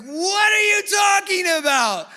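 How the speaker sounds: loud, high shocked shout; shocked outcry. Retold or imitated. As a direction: blurt it out in disbelief. Shocked crowd reaction.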